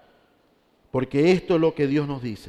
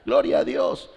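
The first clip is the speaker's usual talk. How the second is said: high mock cry dropping into a hoarse-edged whisper; mock frenzied shouting; 5 semitones higher than usual. Preacher mimicking emotional event-goers who shout and jump, mock frenzy. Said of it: "grita, salta, patalea".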